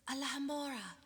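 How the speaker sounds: plain reading voice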